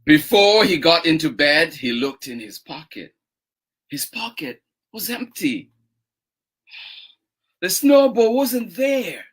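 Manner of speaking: storytelling with drawn-out phrase endings; expressive story reading with long pauses; picture-book narration. A narrator's reading. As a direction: read slowly to little children, savouring each step. Reads picture book aloud.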